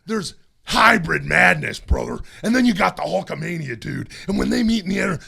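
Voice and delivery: loud, shouting wrestling promo, brother; Hulk Hogan promo. Shown as a demonstration. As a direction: yell the promo like a hyped wrestler. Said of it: "Hulk Hogan promo held".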